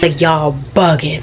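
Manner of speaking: loud outburst. Real, not acted. Reacting to Starbucks prices.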